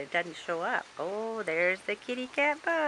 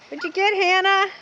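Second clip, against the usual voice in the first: excited call; 9 semitones higher, 12 dB louder, much faster than usual. Real, not acted. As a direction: call out eagerly. Calling out to child.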